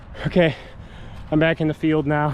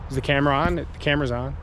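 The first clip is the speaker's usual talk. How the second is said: ordinary talk, nothing marked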